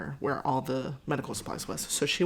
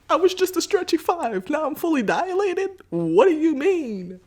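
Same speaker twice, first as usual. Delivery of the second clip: much higher and louder, squealing in shock; shocked disbelief; 12 semitones higher, 9 dB louder than usual. Retold or imitated. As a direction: blurt it out in total disbelief. Disbelief at sudden full dilation.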